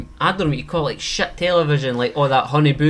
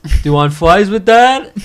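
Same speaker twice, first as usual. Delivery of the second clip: loud, mangled fast-food worker voice; exaggerated injured-server impression; McDonald's Fry Girl; 5 semitones higher, 8 dB louder than usual. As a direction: call it out in a grotesque put-on voice. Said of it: "had some kind of hideous accent".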